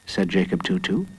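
ordinary delivery, nothing marked

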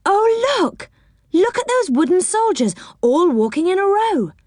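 put-on high, excited child pointing; thrilled girl. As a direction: gasp it out, wide-eyed with wonder. Beth thrilled by soldiers.

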